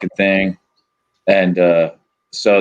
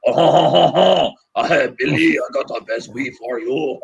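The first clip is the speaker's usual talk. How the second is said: hammed-up thick French accent, higher pitched; mock French dealer with a big chortling opening; Pierre; 4 semitones higher than usual. Weed dealer pitching his stuff to Billy, exaggerated French accent. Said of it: "but he still talks like".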